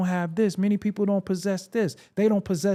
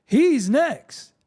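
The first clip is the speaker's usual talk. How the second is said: mocking gossip; 6 semitones higher, 4 dB louder than usual. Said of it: "you got people saying".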